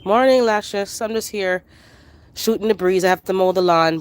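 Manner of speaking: ordinary talk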